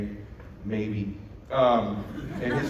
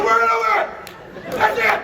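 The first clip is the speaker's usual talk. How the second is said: high, loud pep shout; fired-up shout; 8 dB louder than usual. Retold or imitated. Dad firing up his son before the back nine, shouting. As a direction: roar it like a fired-up coach.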